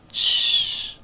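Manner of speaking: unvoiced hush; a sharp hush; shushing. A narrator's reading. Chistido en la casa. (un chistido seco)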